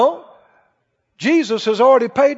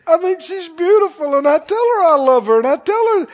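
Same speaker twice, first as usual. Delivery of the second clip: put-on high, urgent pleading voice of a flustered young husband; 7 semitones higher, 3 dB louder than usual. Young husband pleading with the pastor, high and earnest. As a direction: protest your innocence with a nervous laugh. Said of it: "He said".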